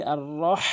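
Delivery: "আর রহ" ending in pressed pharyngeal h; throaty HA. Shown as a demonstration. Teacher corrects the mistake, "রহ" with throaty h. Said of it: "হাটি হলকের মাঝখান থেকে".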